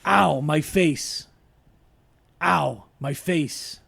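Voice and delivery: very slow, drawn-out Pacino line. Shown as a demonstration. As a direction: drag it out like Pacino. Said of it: "read them out loud in the voice".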